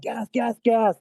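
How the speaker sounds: high-pitched imitation of people freaking out; hammed-up frantic warning; mock panicked alarm. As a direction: cry out like a panicking crowd, in retelling. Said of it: "freaking out".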